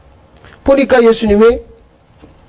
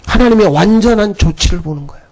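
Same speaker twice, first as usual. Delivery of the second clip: ordinary delivery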